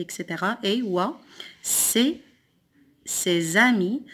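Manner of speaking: ordinary talk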